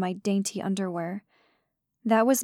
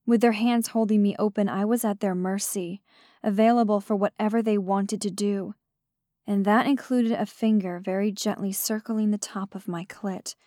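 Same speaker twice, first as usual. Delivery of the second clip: ordinary delivery, nothing marked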